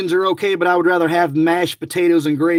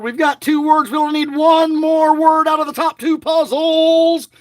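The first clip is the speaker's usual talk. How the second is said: excited; 10 semitones higher than usual. Real, not acted. Close to winning the prize.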